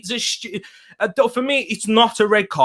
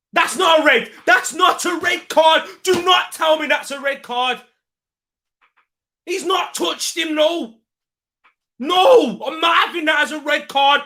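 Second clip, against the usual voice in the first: furious protest; 9 semitones higher than usual. Real, not acted. Fan yelling at the TV that it's not a red, furious. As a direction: shout down the referee's decision.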